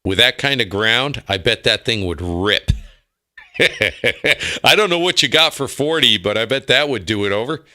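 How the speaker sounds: ordinary talk, nothing marked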